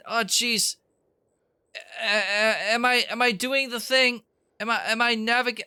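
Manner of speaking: put-on high, fast, stuttering anxious kid; stammering nervous teen voice. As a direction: keep it jittery and fretful, lots of "oh jeez". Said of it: "I need to keep talking like this".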